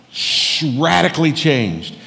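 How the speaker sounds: normal talking voice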